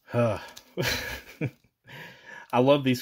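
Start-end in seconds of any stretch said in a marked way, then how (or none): none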